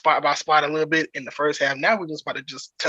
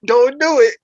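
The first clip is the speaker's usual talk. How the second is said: mock warning; 9 semitones higher, 9 dB louder than usual. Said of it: "She says".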